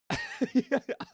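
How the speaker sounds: a high burst of laughter; short laugh. Real, not acted. Streamer laughing at chat's point about the regular sound, a quick high laugh. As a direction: laugh at the joke.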